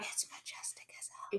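hushed unvoiced gasp of awe; awed whisper. Real, not acted. Gasping at Scotland's unicorn, awed whisper. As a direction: whisper it in wonder.

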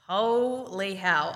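drawn-out, stunned reenactment; retold disbelief. Player reacting to surprise selection news, stunned disbelief. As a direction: stretch it out slowly, as if stunned.

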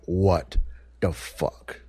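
low, slowly spaced-out retold shock; stunned disbelief. Tenant retelling finding water damage, low stunned disbelief. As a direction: let it drop away, dumbfounded. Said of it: "We're freaking out".

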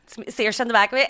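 ordinary talk, nothing marked

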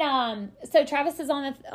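ordinary talk

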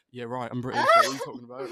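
loud put-on British accent. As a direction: insist loudly in a mock British accent. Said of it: "I'm over here doing the accent".

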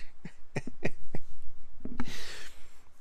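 a burst of real unvoiced laughter; breathy laugh. Real, not acted. Storyteller cracking up at his friend's joke, laughing breathily.

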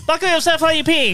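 high, fast mock announcement; mock flight attendant. Joking about a toilet seatbelt, high chirpy mock announcement. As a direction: play it like a chirpy cabin announcement.

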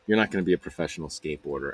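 low, quick, softened put-down; dismissive put-down. Retold or imitated. Stepsister tells him he won't go pro, dismissive.

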